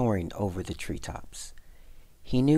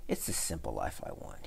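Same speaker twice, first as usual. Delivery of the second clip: low, hushed, near-whispered; gentle, whispery parting words; soft farewell; 9 semitones lower, 8 dB quieter than usual. A narrator's reading. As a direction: drop to a quiet, private confession. Country mouse's parting words.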